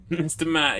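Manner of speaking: calling out. Real, not acted. Calling to a helper again.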